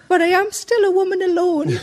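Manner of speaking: lightly voiced frail old lady, half laughing; pensioner voice. To the breakdown operator, a lightly put-on pensioner voice. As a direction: play a hint of a helpless pensioner. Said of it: "in my best pensioner voice".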